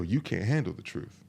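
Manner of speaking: low, soft take on the film line; understated retort. Retold or imitated. Guys deflecting when asked for honesty, understated retort. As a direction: toss the famous line off quietly. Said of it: "what a lot of guys go".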